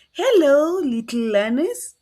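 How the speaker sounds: put-on loud cheerful hello to kids; loud welcome to little learners; bright loud greeting. Shown as a demonstration. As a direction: call out to the class. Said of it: "teacher greets class loudly".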